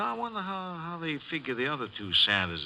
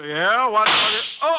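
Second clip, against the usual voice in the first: loud and high, sudden alarm; startled shout; 11 semitones higher, 10 dB louder, slower than usual. Acted. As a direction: bark it out in shock. Answering the door, startled.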